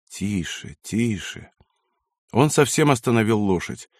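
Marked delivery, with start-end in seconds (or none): 0.1-1.5 soft, drawn-out hush to a horse; calm the horse quietly; low-key, gentle shushing; soothing. A narrator's reading. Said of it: "успокаивал он лошадь".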